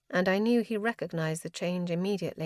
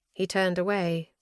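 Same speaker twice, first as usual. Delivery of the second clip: ordinary delivery, nothing marked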